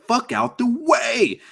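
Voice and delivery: high, drawn-out mock order; imitated brush-off. Wrestler to the referee as retold, high impatient brush-off. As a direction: act out shoving someone aside, slow and high. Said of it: "Joey is pushing the ref out of the way".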